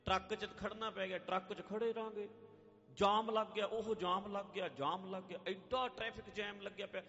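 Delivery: fussy, high mock complaining; mock irritation. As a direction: imitate someone whining about delays. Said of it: "ਹੁਣ irritate ਹੋਈ ਜਾ".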